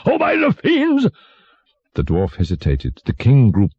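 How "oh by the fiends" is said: high-pitched furious exclamation; shouted royal oath. A narrator's reading. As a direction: curse like an outraged tyrant. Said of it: "shouted the monster".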